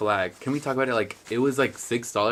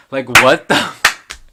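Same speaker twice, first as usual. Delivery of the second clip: loud, slow, drawn-out disbelief; half-laughing what the; stunned mock outrage; incredulous; 14 dB louder, slower than usual. Real, not acted. Reacting to dumb videos.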